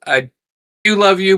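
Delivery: usual speaking voice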